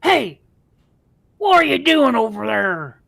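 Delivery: loud high ogre call; dopey cartoon bellow. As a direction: holler like a dumb ogre. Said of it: "And he's like".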